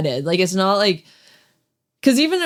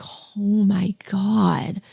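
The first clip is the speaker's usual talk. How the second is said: drawn-out, whispered reaction; awed whisper; much slower than usual. Retold or imitated. Listener recalling the shock of hearing that, awed whisper. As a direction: whisper it very slowly, stretching each word. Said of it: "I'm like".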